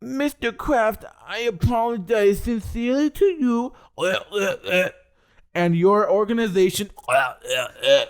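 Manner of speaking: mocking high groveling apology voice with stammered uhs; high, put-on voice of a player grovelling to his boss; Antonio Brown apology. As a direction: ham up a whiny, fake-sincere athlete begging the owner. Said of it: "he goes on his Instagram three days ago".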